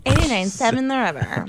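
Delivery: mock radio announcer voice. Shown as a demonstration. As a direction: ham up a radio DJ. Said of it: "That's my D J voice".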